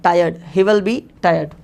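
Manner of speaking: very slow, each word set apart; slow classroom model; slow dictation. Shown as a demonstration. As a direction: say it slowly for learners to copy. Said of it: "so आप कहेंगे he".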